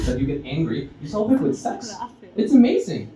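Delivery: ordinary talk, nothing marked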